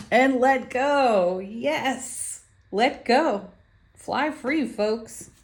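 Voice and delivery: high, loud, drawn-out release; exultant cheer. Real, not acted. Card reader urges folks to let go, exultant and high. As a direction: cheer them on with long, lifted vowels.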